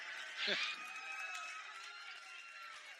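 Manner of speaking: high, breathy burst of laughter after nearly swearing; sheepish giggle; embarrassed laugh. Real, not acted. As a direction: laugh it off, caught out.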